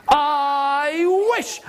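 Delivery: long vowel swooping upward; building wish. Acted. Wife making a magic wish, drawn-out rising swoop. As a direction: slide up high and burst onto the last word.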